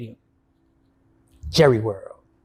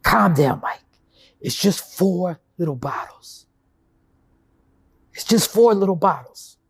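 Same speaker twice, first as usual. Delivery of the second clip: self-soothing; 2 semitones lower than usual. Retold or imitated. Calming himself after the relapse.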